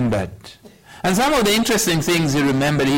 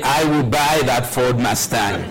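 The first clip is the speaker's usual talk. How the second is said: laughing; 2 semitones lower than usual. Real, not acted. Joking about buying his dream car.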